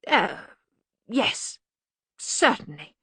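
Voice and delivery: hesitant, tactful agreement; diplomatic. A narrator's reading. Ponder humouring his superior, diplomatically. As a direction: agree carefully, with pauses. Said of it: "said Ponder diplomatically".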